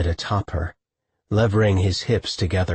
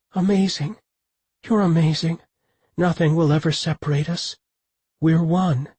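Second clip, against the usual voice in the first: woman's voice; 8 semitones higher than usual. A narrator's reading. Woman in rapture.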